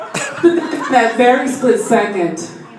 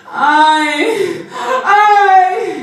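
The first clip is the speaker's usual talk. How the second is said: high, loud wail; yelling; 9 semitones higher, 5 dB louder than usual. Retold or imitated. Mother yelling in the night, imitated high wail. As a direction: imitate a mother's drawn-out yell. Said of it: "she's yelling".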